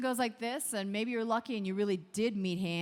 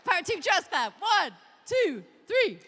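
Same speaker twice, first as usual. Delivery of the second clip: loud, high rallying count; shouted Power Team Trust Clap count; hype call; 6 semitones higher, 11 dB louder than usual. Real, not acted. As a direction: pump the room up. Hyping the crowd count.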